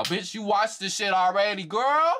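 shrill disbelieving rant, voice climbing; exasperated high rant. Real, not acted. Ranting at the cast for faking surprise, voice climbing high.

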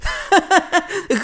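a high, breathless belly laugh; high giggling laugh. Acted. Little Robin laughing at his friend's messy hair. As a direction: laugh till you hold your stomach. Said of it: "Робін так сміявся".

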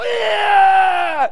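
high, loud, drawn-out group holler; kids' yell. Retold or imitated. Sons reacting to their dad, high loud mock yell. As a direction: imitate boys yelling at the top of their voices.

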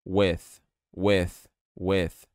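WITH ending in airy, voiceless TH; each WITH separate and firm, breath out on the TH; TH tongue between teeth. Shown as a demonstration. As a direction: drill WITH, tongue between the teeth, releasing air. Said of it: "colocar a língua entre os dentes".

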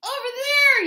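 high, loud far-reaching call; yelling. A narrator's reading. Fox calls from the bank, loud high yell. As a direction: yell across the water. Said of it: "yelled Fox".

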